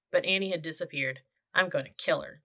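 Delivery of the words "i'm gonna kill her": plain reading voice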